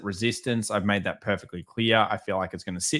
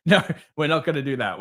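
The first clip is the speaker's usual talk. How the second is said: a higher, amused no; playful refusal; 6 semitones higher than usual. Real, not acted. Laughing off a viewer's gold short, playfully refusing. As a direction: brush the idea off with a laugh.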